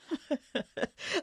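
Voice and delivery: a light, fond laugh at the memory. Acted. Old family friend to the young lord, a fond laugh.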